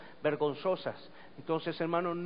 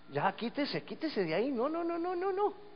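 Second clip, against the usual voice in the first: softer voice climbing to high repeated refusals; protesting palm tree; 9 semitones higher, 4 dB quieter than usual. Acted. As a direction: plead with rising pitch as you are smothered. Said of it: "Ya decía la palmita". (palmita que protesta, cada vez más agudo)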